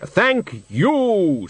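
slow, hollow, solemn deathly voice; Death's voice. Acted. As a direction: speak as grave, patient Death. Death cuts in.

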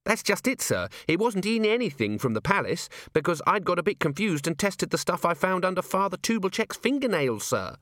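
a keen junior officer reporting up; young dwarf Cheery. A narrator's reading. Cheery nervously reporting her test to Vimes, eager.

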